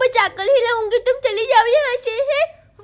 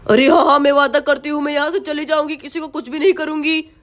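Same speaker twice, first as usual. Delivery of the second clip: lower, eager promising witch; 6 semitones lower than usual. Acted. (वादा करती चुड़ैल)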